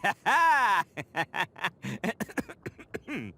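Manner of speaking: big boastful guffaw; proud hearty laugh. Acted. Ito laughing proudly at his own intro. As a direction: laugh like you just won everything.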